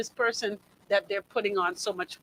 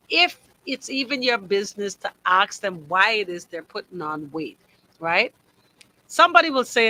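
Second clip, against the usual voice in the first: normal talking voice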